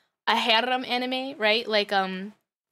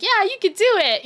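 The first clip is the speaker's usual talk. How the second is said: higher-pitched cheering-on; lightly acted peppy encouragement; mock encouragement; 8 semitones higher, 3 dB louder than usual. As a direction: cheer them on from the sidelines. Cheering on the workers.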